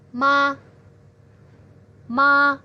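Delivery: ma short and light; ma quick and toneless; neutral tone. Shown as a demonstration. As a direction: clip ma lightly with no contour. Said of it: "seperti tidak bernada dan ringan".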